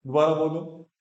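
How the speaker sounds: normal talking voice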